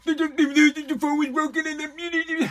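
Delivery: high, quick stammering mock of the excuse; mocking imitation. Host mocks the dog thief's stammered excuse, high mocking babble.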